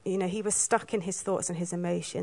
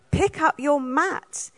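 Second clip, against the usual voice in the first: stern slow command; 6 semitones higher, much slower than usual. Shown as a demonstration. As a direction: Pick up your mat slowed right down, up stressed with a rise. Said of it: "add that bit of tone".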